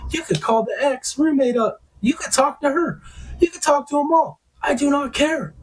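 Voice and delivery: retold argument, pitched far up; exasperated pleading. Telling her to check with everyone, exasperated. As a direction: reenact a desperate, insistent defense at high pitch. Retelling his desperate defense in an argument.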